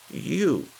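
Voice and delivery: put-on old lady choosing him; old lady's voice. A narrator's reading. Missus Bellows decides.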